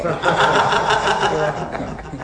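real laugh spilling into the words. Real, not acted. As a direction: laugh through the punchline. Laughs at his own joke.

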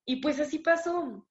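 ordinary delivery

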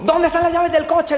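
high, loud frantic shout; a boss yelling around the house for his car keys; yelling like crazy. Acted. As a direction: scream for the car keys in a rage. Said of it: "gritando como loco".

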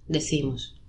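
ordinary delivery

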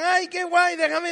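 agudo y fuerte, pidiendo; niño suplicante. Retold or imitated. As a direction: pide prestado como un crío ansioso. Amigos pidiendo el juguete. (amiguito suplicante, muy agudo)

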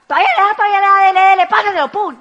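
high, loud hurried egging on; excited urging. Retold or imitated. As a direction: urge the driver through the light. Young drivers urging through red light.

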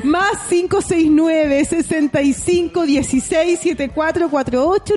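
high, slow announcer read of the number; radio announcer read. Acted. Host giving listeners the WhatsApp number, slow announcer read.

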